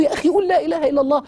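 high, loud and fast, pressing hard; angry urging. Retold or imitated. Friend angrily urges the dying man to say the creed, shouting fast. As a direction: push him to say it, losing patience. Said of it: "اتعصبت".

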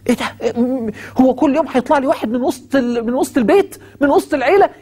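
Pharaoh fuming that another one popped up; indignant Pharaoh. Acted. Pharaoh's inner outrage voiced.

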